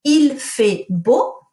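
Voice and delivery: Il fait beau very slow and loud, each word spaced; teacher modelling Il fait beau for pupils; slow model sentence. Shown as a demonstration. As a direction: spell out Il fait beau slowly. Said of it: "If I say".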